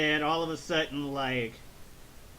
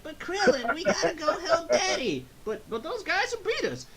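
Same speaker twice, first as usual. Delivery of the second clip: Gohan; 13 semitones higher than usual. Acted. Gohan pleading with Krillin to go help Daddy, high mock child voice. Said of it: "Gohan's like".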